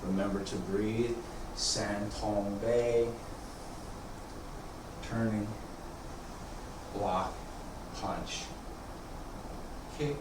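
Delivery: quiet unhurried cues, guiding the form; serene tai chi cues. Acted.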